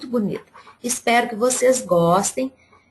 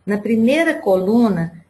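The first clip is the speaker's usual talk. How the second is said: ordinary talk, nothing marked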